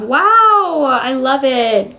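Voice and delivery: high, swooping fake wonder; sarcastic amazement. Praising her miserable life to viewers, sarcastically amazed. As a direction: swoop up high in mock delight.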